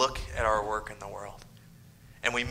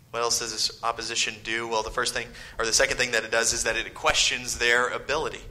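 ordinary talk, nothing marked

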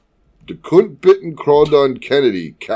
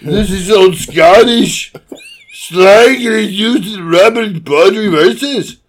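put-on loud, high mangled Scots-Austrian accent; Scottish Schwarzenegger; 6 semitones higher, 5 dB louder than usual. Co-host rereading the slang entry as Scottish Schwarzenegger. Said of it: "Authentic Scottish Schwarzenegger".

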